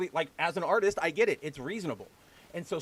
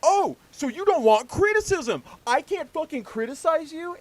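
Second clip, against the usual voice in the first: high, loud mock outrage; losing their minds; 11 semitones higher, 6 dB louder than usual. Imitating people who lose their minds over moderation, mock outraged. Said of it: "everybody loses their minds and goes".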